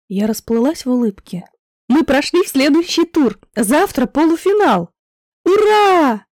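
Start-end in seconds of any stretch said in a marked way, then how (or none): 1.9-4.9 excited. A narrator's reading. Sharing happy news. 5.4-6.3 high, loud squeal of joy; squealing cheer. A narrator's reading. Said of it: "взвизгнула Лиля".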